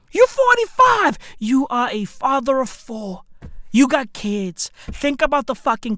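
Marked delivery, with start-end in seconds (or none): 0.1-1.2 high-pitched, exasperated reproach; exasperated scolding. Acted. Man scolding a middle-aged father smoking hubbly, exasperated high pitch. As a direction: scold him in a high, incredulous voice. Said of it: "I be like, Bruh".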